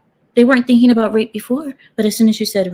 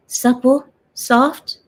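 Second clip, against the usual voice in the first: usual speaking voice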